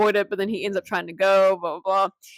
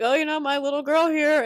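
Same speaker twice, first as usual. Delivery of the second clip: lightly put-on higher voice; acted quote; 7 semitones higher than usual. Retelling a sitcom scene to a friend, lightly acted quote. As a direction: quote him in a raised voice.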